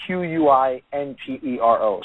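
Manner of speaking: Quintero given letter by letter; Quintero spelled out. Shown as a demonstration. Guest spelling his surname for listeners, Quintero letter by letter. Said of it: "it's spelled".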